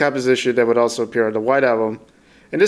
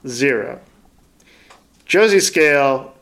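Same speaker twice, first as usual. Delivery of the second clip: normal talking voice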